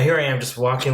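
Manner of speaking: usual speaking voice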